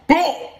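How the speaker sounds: high, loud "boom" for the hit; knockout sound effect. Retold or imitated. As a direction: burst out with a high-pitched impact noise. Imitates a knockout blow.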